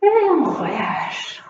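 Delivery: slow, low, soothing release; slow calming cue. Real, not acted. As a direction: ease them into letting go. Instructor cues relaxation slowly.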